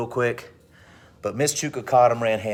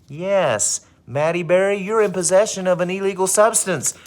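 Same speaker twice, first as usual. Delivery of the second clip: loud, high pronouncement; acted, raised-voice indictment; triumphant accusation; 9 semitones higher, 5 dB louder than usual. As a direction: declare the charge like a gloating principal. Principal announces accusation.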